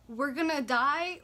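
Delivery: a stretched, frightened cry; retold panic. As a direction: relive the terror of the crash. Panicked thought before crash.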